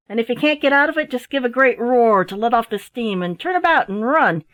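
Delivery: put-on a sailor gravely teasing the boy; gruff sailor. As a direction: sea dog giving blunt advice. Sailor's voice in story.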